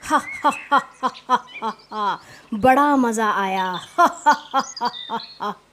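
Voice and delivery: staged ha-ha laughter trailing off; acted prankster's laughter; hammed-up belly laugh. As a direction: laugh mockingly, stretching the gloating words. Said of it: "चरवाहा पेट पकड़कर हंस रहा था".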